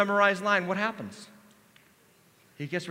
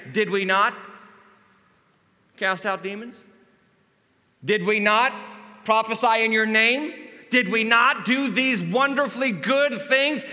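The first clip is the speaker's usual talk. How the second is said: louder, higher pleading; pleading protest; 5 semitones higher, 7 dB louder than usual. Acted. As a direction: beg to be let in. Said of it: "they're gonna say".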